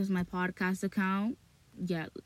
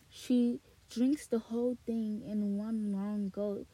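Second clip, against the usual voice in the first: softer bedtime-story narration; 5 dB quieter than usual. Acted. Reading the book aloud.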